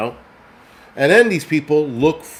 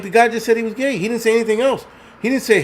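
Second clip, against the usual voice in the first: higher, incredulous protest; exasperated high protest; 9 semitones higher, faster than usual. Retold or imitated. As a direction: throw up your hands in disbelief. Exasperated retort in story.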